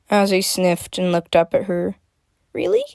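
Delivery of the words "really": tiny, very high question; squeak. A narrator's reading. Sniffling Azzy trying to smile back at Freya, squeaking. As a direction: squeak it hopefully. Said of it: "she squeaked, trying to smile".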